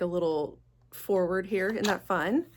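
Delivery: normal talking voice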